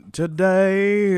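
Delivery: drawn-out Southern Today. Retold or imitated. Host imitates the coach's drawn-out Today, in Southern drawl.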